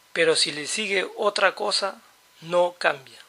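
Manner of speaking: ordinary talk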